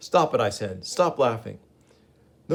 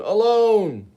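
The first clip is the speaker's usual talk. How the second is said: much higher, louder despairing cry; anguished wail; 11 semitones higher, 7 dB louder than usual. Acted. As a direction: let the word break into a wail. Gibbon's lament peaks.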